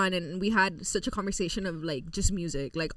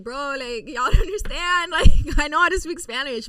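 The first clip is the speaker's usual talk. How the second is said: higher, louder exasperated protest; exasperated retort; 7 semitones higher, 8 dB louder than usual. Retold or imitated. Host answers online commenters in a higher, louder protest. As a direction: push back at the comments, rising. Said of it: "I'm like".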